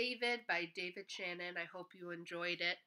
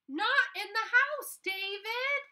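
high, loud scolding mother's cry; fed-up mom yelling at her son; 12 dB louder, slower than usual. Acted. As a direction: call it out like an exasperated mom. Mom scolding ball play.

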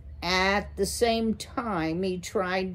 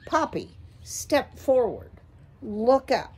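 higher, urging father mouse; coaxing father; 6 semitones higher than usual. Acted. A father mouse urging his daughter to step up, coaxing. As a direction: nudge a shy daughter forward.

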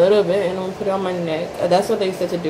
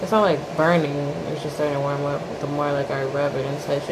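speaking through fingers on mouth; fingers at lips; 4 semitones lower, 4 dB quieter than usual. Real, not acted. Seen 1: Fingers press sheet mask around lips while she speaks; hands drop after.

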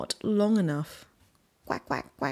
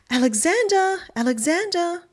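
much higher, louder anxious call; 11 semitones higher, 4 dB louder than usual. Acted. Mother duck calling her missing duckling, high and loud. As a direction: call out across the street for him.

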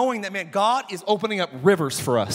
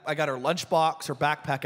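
normal talking voice

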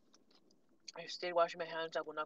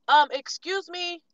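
high, loud, drawn-out excuse me; sassy excuse me; 9 semitones higher, 14 dB louder, slower than usual. Retold or imitated. Sassing the cashier who touched her fries. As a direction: give him full attitude.

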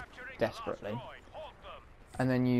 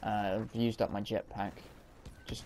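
normal talking voice

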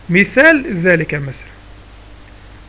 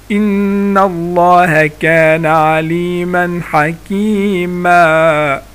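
ترتيل؛ تلاوة بطيئة مجودة أعلى صوتًا؛ رتّل الآية كقارئ في المسجد؛ تلاوة مرتلة بإظهار التنوين; 4 dB louder, much slower than usual. Shown as a demonstration.

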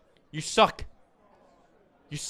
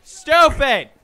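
angry yelling; 6 semitones higher, 8 dB louder than usual. Real, not acted. Yelling at disruptive players.

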